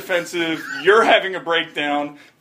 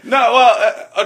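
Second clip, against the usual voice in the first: laughing; 7 semitones higher, 7 dB louder, slower than usual. Real, not acted. Laughing at the quote reaction.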